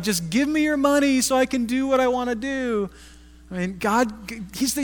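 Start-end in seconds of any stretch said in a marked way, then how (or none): 0.0-2.9 higher mock whine; mock whiny God demanding cash; whining. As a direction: nag for money like a spoiled beggar. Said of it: "He's not whining, like".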